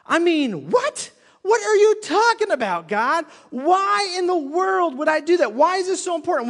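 like someone who cannot believe what they just heard; high, loud what-are-you-talking-about protest; incredulous. Acted. Voicing the congregation's disbelief at God.

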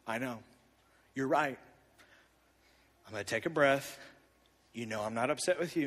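softly reassuring his daughter he isn't upset. Retold or imitated. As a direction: settle down and reassure her gently. Calming self, reassuring daughter.

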